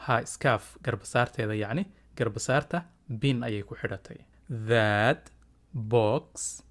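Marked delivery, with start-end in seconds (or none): 4.5-6.6 slow, isolated words with a pause after each; separated, deliberate teacher delivery; word by word for repeating. Shown as a demonstration. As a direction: model it one word at a time for learners. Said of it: "Iga daba dheh".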